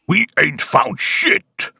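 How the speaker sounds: high, slow, drawn-out shouted quote; mock soldier calling out slowly; movie line impression. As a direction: ham up the famous line, stretching every word. Quoting a famous movie line.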